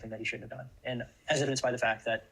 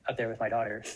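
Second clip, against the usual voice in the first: usual speaking voice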